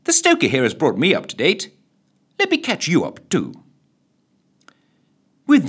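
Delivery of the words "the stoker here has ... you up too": plummy lady voice. Acted. Lily continues.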